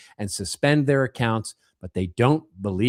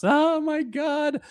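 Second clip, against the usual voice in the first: much higher, very slow mock gasp; mock horror; much slower than usual. Host reacts to the headline with stretched mock horror. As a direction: stretch it out in fake shock.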